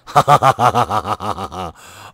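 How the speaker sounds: loud delighted burst of laughter; gleeful laugh. Real, not acted. Gamer watching titanium smelt, laughing with glee. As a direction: laugh out loud with pure joy. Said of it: "that makes me so happy".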